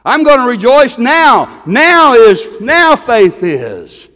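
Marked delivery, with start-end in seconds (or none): 2.6-4.1 now leaned on, very slow and falling; stress on now. Real, not acted. Preacher pressing a point on the congregation, now stressed slowly. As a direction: drag out now faith is. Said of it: "repeated for emphasis".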